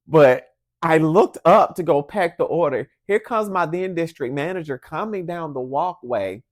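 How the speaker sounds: ordinary delivery, nothing marked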